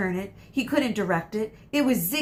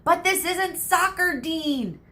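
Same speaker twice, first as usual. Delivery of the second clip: raised, higher retort; yelled back; 9 semitones higher, 4 dB louder, slower than usual. Acted. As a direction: shout it back at the crowd. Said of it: "Ron yelled back".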